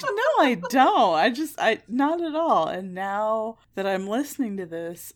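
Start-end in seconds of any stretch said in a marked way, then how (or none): 0.0-2.7 high, loud protest; mock-indignant no I don't; shrill retort to ex; insistent denial. Denying she has an accent.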